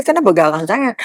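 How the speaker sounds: normal talking voice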